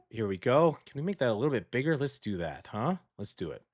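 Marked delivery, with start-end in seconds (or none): 2.0-3.6 muttering to self. Real, not acted. Muttering while adjusting image.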